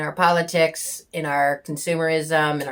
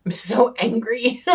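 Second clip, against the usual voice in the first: ordinary talk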